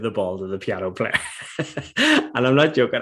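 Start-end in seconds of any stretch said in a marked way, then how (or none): 1.6-3.0 real laughter bubbling through, louder and higher; laughing while retelling the story; cracking up at the memory. Real, not acted. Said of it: "I'm still laughing now".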